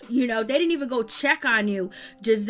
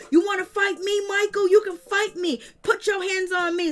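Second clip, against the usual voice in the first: mock challenge; 6 semitones higher than usual. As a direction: call him out to square up. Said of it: "Candace was like".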